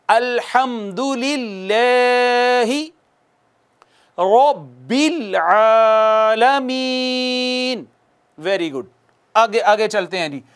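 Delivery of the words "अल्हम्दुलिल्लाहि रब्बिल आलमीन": slow, drawn-out tajweed recitation; Quran recitation. Shown as a demonstration. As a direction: recite it slowly and melodically for the class. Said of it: "आप मुझे follow कीजिएगा". (धीमी तजवीद वाली तिलावत)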